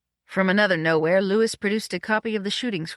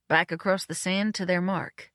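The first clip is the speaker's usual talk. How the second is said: usual speaking voice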